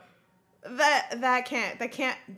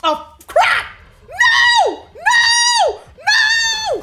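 very high, full-force screams; horrified screaming; 14 dB louder, much slower than usual. Real, not acted. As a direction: scream out in real shock. Screaming at a shocking scene.

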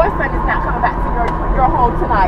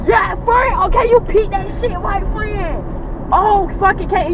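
excited call-out; 7 semitones higher, slower than usual. Real, not acted. Calling him out to friend.

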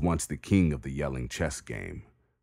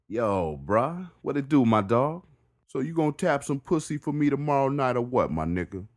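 higher, louder streetwise neighbor; Jamal voice; 10 semitones higher, 4 dB louder than usual. Acted. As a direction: call it teasing through the bars.

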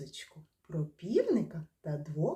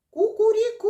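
very high, loud rooster crow; faster, louder crow; 12 dB louder, faster than usual. Shown as a demonstration. Teacher urges children faster and louder, ringing rooster crow. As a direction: crow it out quickly at full voice. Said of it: "А тепер давайте швидше та голосніше".

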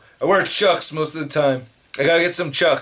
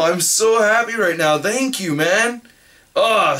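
normal talking voice

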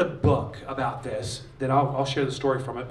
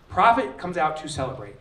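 normal talking voice

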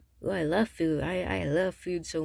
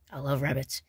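usual speaking voice

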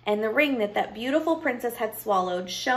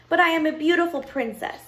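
high, self-important protest; indignant princess; 7 semitones higher, 3 dB louder than usual. Acted. Princess protests to the counting witch, indignant. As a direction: insist on your rank in a high voice.

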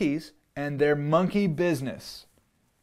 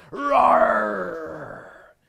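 higher, louder shouted roar; monster roar; 7 semitones higher, 7 dB louder than usual. Acted. Narrator playing wild things for kids, loud shouted roar. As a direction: let out a big playful roar. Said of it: "The wild things roared their terrible roars".

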